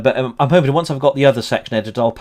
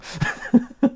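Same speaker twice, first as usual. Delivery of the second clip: breathless laugh; 13 semitones higher, 5 dB quieter than usual. Real, not acted. Laughing at the film.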